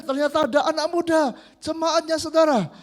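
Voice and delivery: ordinary delivery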